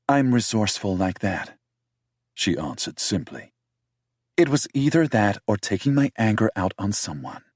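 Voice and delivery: defensive British woman voiced high; heroine's voice.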